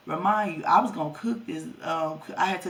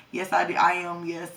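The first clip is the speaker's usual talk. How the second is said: usual speaking voice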